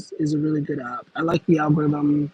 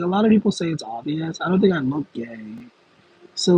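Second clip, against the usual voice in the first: ordinary talk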